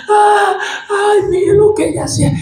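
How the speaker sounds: high, sing-song mock lament; hammed-up wailing. Weeping widows show Pedro her work, mock wailing.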